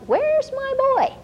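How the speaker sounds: sudden motherly alarm; worried surprise. Retold or imitated. Mom suddenly notices quiet.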